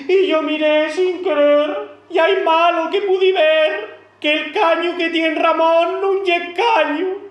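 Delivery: put-on high, slow girl's voice confessing to her mother; young daughter character. Daughter confessing to her mama about Ramón, high, coy and flustered.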